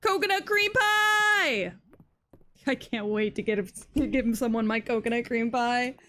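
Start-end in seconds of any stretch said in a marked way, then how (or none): 0.0-1.7 high, loud and slow, savouring each word; excited shout. Real, not acted. Player cheers finding the coconut cream pie, excited shout. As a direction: announce it like a prize.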